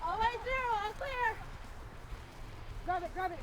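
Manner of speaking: loud high shouting; calling the ball. Real, not acted. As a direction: yell instructions mid-play. Shouting instructions during play.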